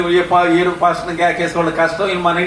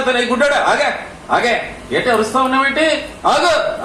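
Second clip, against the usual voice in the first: crowd rebuking; 7 semitones higher than usual. Retold or imitated. Crowd rebuking the blind beggar, high mock shout. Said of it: "గద్దిస్తా ఉన్నారు".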